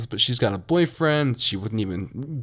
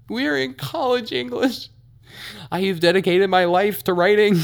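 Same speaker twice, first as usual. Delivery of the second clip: higher, exasperated outburst building up; worked-up rant; 11 semitones higher than usual. Real, not acted. Venting to viewers about the classmate's writing, exasperated and climbing.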